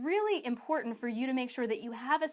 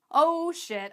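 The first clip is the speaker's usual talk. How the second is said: higher, louder "oh shit"; louder mock alarm; 5 semitones higher, 6 dB louder than usual. Coach voices most people realizing they need a system, louder mock alarm. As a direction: act out the realization hitting full on.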